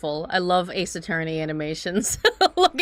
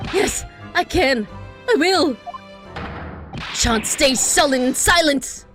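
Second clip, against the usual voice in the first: eager exclamation; 7 semitones higher than usual. Acted. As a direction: burst out eagerly. Prisoner eager.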